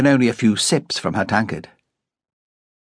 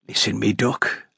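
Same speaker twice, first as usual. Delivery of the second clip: gentle; 5 dB quieter than usual. A narrator's reading. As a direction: ease her in tenderly. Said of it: "said gently".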